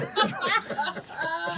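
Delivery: high burst of laughter; big laugh. Real, not acted. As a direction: laugh out loud at the memory. Laughing at memory.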